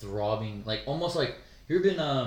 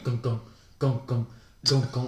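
imitated throbbing. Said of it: "veins are just like".

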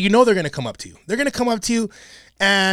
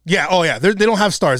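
ordinary delivery

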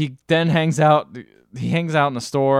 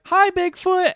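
put-on very high innocent kid greeting; little kid voice; slower than usual. Imitating the kid greeting the fake Bigfoot in a high child voice. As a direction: chirp it like a cheerful small child.

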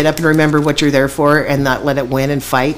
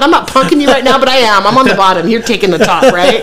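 mock bossy; 8 semitones higher, 4 dB louder than usual. Teasing cellmate over bunks.

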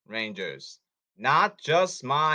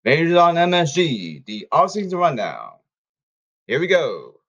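tv announcer; 8 dB louder than usual. Pretend. Announcing the show segment.

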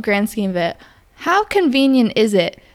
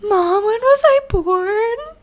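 high, slow whiny plea to mom; pretend phone call; 11 semitones higher, slower than usual. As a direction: act out calling mom.

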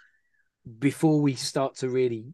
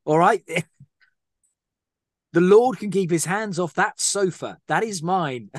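hammed-up Herb; 6 semitones higher than usual. Voicing Herb, laughing.

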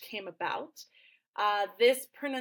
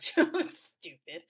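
higher-pitched, laughing mock insult; 8 semitones higher than usual. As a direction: play a waiter jeering through giggles. Acting out waiter laughing at listener.

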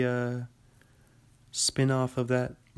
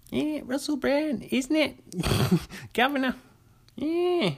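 much higher, hammed-up British accent; bad British accent; 13 semitones higher than usual. Mocking Russell Brand on Joe Rogan in a bad British accent. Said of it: "Terrible, terrible accents".

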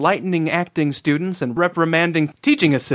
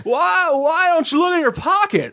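higher, drawly Jimmy Stewart impression; 11 semitones higher than usual. Doorknob ghost speaks.

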